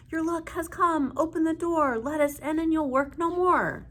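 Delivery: high, loud fairy chant; fairy voices. A narrator's reading. Fairies banging and calling to be let in. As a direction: coax through the door.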